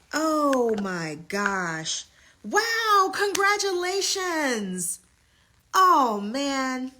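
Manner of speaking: slow, then a high loud wow; awed. Real, not acted. Host overwhelmed by a client's news, slow then high wow. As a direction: let it sink in, then burst high.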